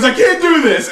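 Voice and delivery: high, loud laughing panic; mock panicked cry. Wrestler losing his nerve before running, mock panic. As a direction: squeal it as you bolt. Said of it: "freaks out and goes".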